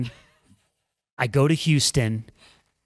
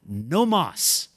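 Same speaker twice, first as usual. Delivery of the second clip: higher-pitched imagined voice of the body; mock ultimatum, pitched up; body's verdict; 6 semitones higher than usual. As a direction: lightly voice the body calling a halt. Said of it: "my body said".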